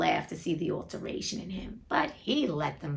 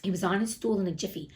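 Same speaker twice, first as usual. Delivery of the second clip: ordinary delivery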